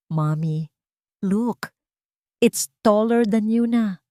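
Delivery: lightly voiced child calling out; excited child. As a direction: show off the tower to Mommy. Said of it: "Sigaw ni Lunas".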